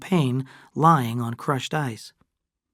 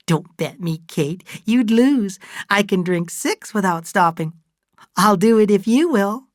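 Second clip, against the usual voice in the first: high, emphatic bragging; Fay, tipsy boasting challenge; 8 semitones higher, 4 dB louder than usual. Acted. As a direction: take up the dare loudly in a high voice.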